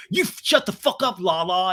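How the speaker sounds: high sputtering retort; mock angry outburst. Sandoval silencing Lala, high mock anger. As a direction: sputter and snap in a mimicked voice. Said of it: "And Sandoval's like".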